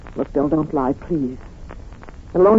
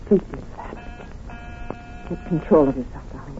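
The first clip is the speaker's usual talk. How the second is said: urgent whisper; 2 semitones lower, 9 dB quieter than usual. Acted. Wife urging before police enter.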